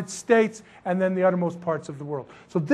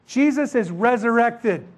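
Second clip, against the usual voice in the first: ordinary talk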